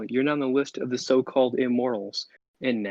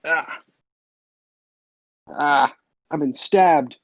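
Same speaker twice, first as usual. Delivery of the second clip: death cry; 2 semitones higher, 6 dB louder, much slower than usual. Acted. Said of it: "he says".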